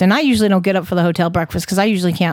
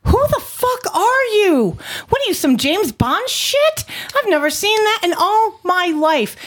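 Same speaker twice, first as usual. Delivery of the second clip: incredulous shock; 12 semitones higher than usual. Retold or imitated. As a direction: shriek it in total amazement. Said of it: "I'm like".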